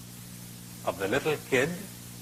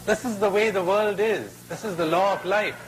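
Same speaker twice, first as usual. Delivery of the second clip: raised, higher pronouncement; lightly voiced crocodile; 7 semitones higher, 3 dB louder than usual. Crocodile lectures the trapped boy, higher and emphatic. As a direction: lay down the law, pitched up.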